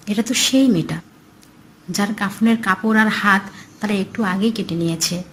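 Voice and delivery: usual speaking voice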